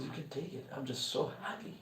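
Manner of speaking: hushed, unhurried blessing; serene deity. Acted. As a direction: speak as a peaceful god, barely audible. Lord Shiva reassures the thief.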